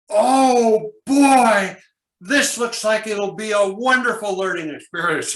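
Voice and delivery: high, loud, gushing delight; overjoyed enthusiasm. Shown as a demonstration. Student greeting the first handout, hammed-up delight. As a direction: gush over the handout like a gift. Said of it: "this is what you say".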